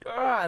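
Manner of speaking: high, loud open-throated yell; short cathartic scream. Shown as a demonstration. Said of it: "you really yelled".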